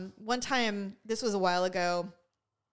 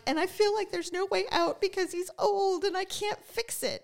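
tearful; 9 semitones higher than usual. Real, not acted. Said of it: "I started to cry".

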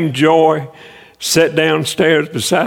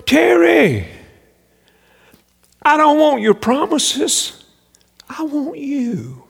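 high, slow, tender voice fading to almost nothing; voice of God; 8 semitones higher, slower than usual. Retold or imitated. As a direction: speak as God, gently and slowly, dropping away at the end. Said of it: "And it was like God said".

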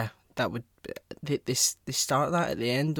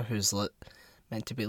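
normal talking voice

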